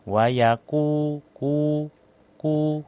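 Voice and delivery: "Wayaku" with a light, thin ku; thin qaf. Shown as a demonstration. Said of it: "itu terlalu tipis". (qaf "Wayaku" dibaca tipis)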